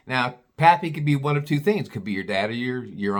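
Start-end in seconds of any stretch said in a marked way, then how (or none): none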